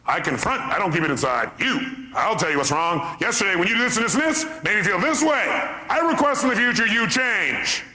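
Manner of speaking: prissy mock lecture rising high; mocking confronter voice. Speaker mocking people who confront others, prissy. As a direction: parody a self-righteous confronter. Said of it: "Little bastards".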